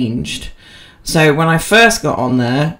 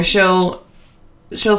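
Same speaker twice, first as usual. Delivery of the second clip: normal talking voice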